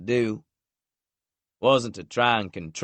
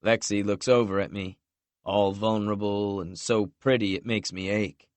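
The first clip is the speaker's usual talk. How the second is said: plain reading voice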